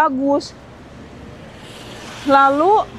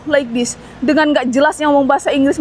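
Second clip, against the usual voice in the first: ordinary delivery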